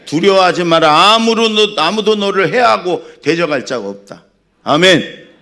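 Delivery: loud, high-pitched proclamation; full-force preaching. Acted. Preacher declares scripture to congregation, full-force preaching.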